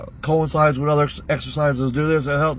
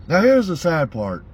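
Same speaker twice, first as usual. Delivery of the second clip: ordinary delivery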